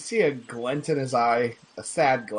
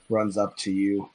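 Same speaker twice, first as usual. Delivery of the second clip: ordinary talk, nothing marked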